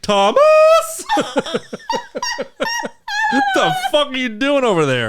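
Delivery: shrieking high through laughter; laugh-yell at a friend in disbelief. Real, not acted. Laughing at a friend's awful Irish accent, high and loud. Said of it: "laughing outburst".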